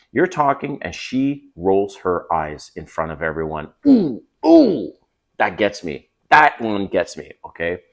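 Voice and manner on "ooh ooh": high pained ooh, ooh. Real, not acted. Man recalling his wife rolling her eyes, a high pained ooh. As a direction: wince out loud. Reacting to eye rolls.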